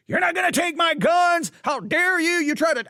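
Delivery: hammed-up indignant shout about guns; angry Texan yelling to keep his guns; West Texas gun owner. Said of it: "it's immediately like".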